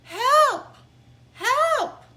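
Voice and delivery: very high, very loud scream for help. Acted. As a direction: scream help at the top of the lungs. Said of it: "scream as loud as he could".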